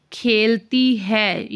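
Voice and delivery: खेलती है drawn out slowly, stress on खेलती; slow emphasis. Shown as a demonstration. Teacher stressing the correct verb खेलती for students. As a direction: lean on खेलती to mark the right form. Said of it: "तो सीता".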